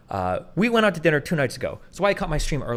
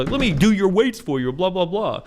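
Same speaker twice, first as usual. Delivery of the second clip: mock pushy guy; 4 semitones higher, 4 dB louder than usual. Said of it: "walk up and say".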